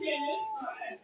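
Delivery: normal talking voice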